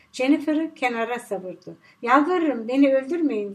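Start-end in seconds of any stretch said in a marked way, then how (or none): none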